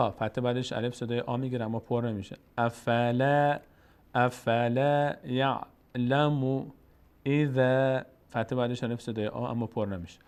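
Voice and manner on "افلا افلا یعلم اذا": slow, drawn-out chanted Arabic recitation; slow Quran recitation. Shown as a demonstration. Teacher slowly reciting the verse for the student, chanted. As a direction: stretch every syllable as in tajweed. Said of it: "teacher recites".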